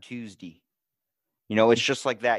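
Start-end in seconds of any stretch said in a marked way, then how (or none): 0.0-0.6 "Tuesday" with the ending reduced to "dee", dropped softly; Baltimore pronunciation. Shown as a demonstration. Answering with the Baltimore version, "Tuesday" ending in "dee". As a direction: say "Tuesday" as "Tooz-dee", quietly. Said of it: "We say Monday".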